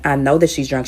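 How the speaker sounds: ordinary delivery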